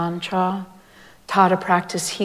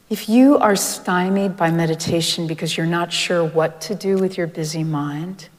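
ordinary talk

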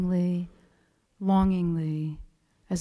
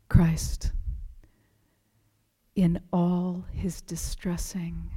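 soft, slow whisper; hushed sacred quote; reverent whisper; 4 dB quieter, slower than usual. Retold or imitated. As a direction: breathe the blessing reverently.

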